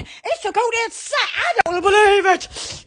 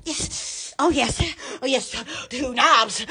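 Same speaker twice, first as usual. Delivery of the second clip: ordinary talk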